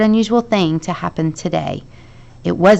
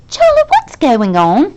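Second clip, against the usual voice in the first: alarmed question; 8 semitones higher, 7 dB louder, slower than usual. A narrator's reading. Alarmed friend asks.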